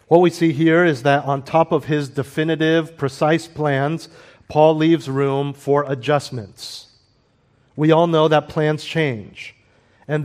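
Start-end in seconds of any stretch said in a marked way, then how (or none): none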